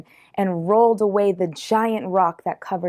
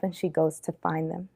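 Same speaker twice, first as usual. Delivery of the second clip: ordinary delivery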